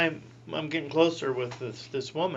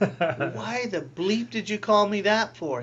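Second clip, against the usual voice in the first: indignant surprise; 7 semitones higher, 5 dB louder, much slower than usual. Retold or imitated. Startled by being called Father.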